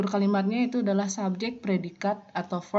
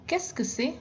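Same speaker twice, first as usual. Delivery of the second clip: higher, falling Qu'est-ce que c'est; high model question; 9 semitones higher than usual. Shown as a demonstration. Teacher gives students an example in a raised voice. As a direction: show it high and clear. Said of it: "misalnya ini".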